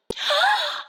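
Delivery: sharp, very high gasp; a sudden thrilled gasp; excited gasp. Acted. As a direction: gasp in delight.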